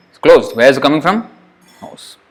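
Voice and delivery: usual speaking voice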